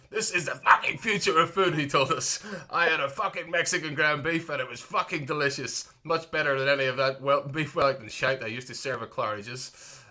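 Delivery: hammed-up London chef, swearing; bad London accent. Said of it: "that was a really shit London accent".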